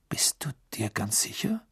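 whispered, barely voiced; hushed voice. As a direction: ask it under your breath. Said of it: "mit gedämpfter Stimme".

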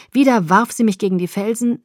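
plain reading voice

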